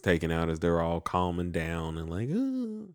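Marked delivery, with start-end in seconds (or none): 2.3-2.9 high sweet falsetto, lightly put on; sweet Puck voice. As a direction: coo it up high like a fond spirit.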